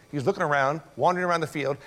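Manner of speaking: normal talking voice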